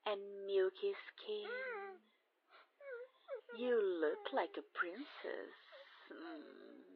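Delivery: ordinary talk, nothing marked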